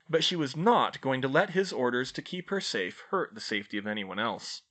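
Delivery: usual speaking voice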